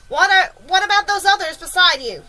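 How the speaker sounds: high, loud demand; shouted question. A narrator's reading. Police chief questions James, shouting. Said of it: "shouted the chief of police".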